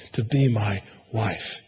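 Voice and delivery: "to be my wife" spoken quietly as a loving vow; tender. Shown as a demonstration. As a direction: let "to be my wife" fall soft and slow, devoted. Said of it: "No, he's saying".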